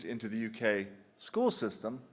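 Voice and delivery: normal talking voice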